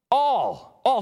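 all said high and strong, right at the front; emphatic all. Shown as a demonstration. Preacher showing Paul's point to the church, emphatic all. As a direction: hit all hard to show Paul's emphasis. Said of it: "he is trying to make a very important point".

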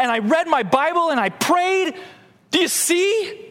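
high, pleading protest to God; pleading boast. Acted. Pastor acting himself at heaven's gate, pleading high. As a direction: beg the Lord, listing good deeds.

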